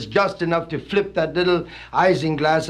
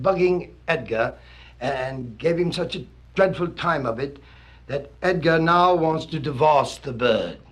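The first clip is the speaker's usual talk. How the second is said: normal talking voice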